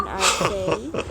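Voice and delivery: ordinary talk, nothing marked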